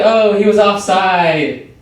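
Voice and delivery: drawn-out, high-pitched whiny soccer player; mock complaining. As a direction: whine it slowly, imitating a griping player. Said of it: "'cause they complain too much".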